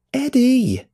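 high, loud shout of a name; angry shout. Acted. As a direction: yell his name angrily, pitch leaping up. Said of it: "Roxy shouts at him. She's angry".

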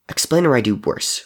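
ordinary delivery

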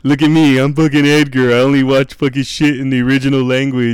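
mock a know-it-all; mocking snob. Mocking friend's snobby voice.

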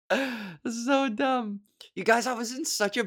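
high giggle into the words; giggling at how silly this is; a giggly, embarrassed laugh over the words. Real, not acted. Laughing at own joke.

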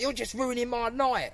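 high-pitched put-upon punter; mock complaint. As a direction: imitate a customer complaining, voice lifted high. Imitating a complaining refused punter.

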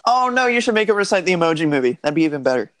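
loud, fast gleeful pitch; excited suggestion. Real, not acted. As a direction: blurt it out with glee.

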